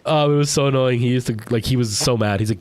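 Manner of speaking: ordinary talk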